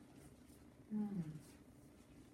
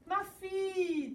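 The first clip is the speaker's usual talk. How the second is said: a mildly raised glad cry; joyful king; 7 semitones higher than usual. Acted. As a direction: welcome her back with joy. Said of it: "dit le roi".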